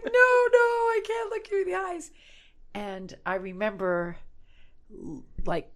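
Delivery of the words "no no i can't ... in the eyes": very high, squeaky mock panic; hammed-up flustered squeal of refusal; panicked refusal. Mortified woman refusing stranger at bar, panicked.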